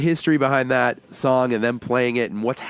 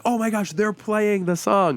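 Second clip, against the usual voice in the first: higher, slower gasp of amazement; amazed fan; 9 semitones higher than usual. Retold or imitated. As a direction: realize it slowly, marvelling. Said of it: "they're like".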